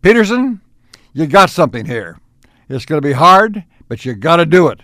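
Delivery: loud, insistent retold praise; terribly emphatic. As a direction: hammer it home like a blunt old professor. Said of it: "even though it was terribly emphatic".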